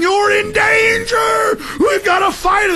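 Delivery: high frantic yelled warning, drawn out; Body Snatchers warning. Retold or imitated. Warning the crowd in Body Snatchers, frantic high yell. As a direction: scream the warning like the last sane man. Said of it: "I'm like the guy at the end of the Body Snatchers going".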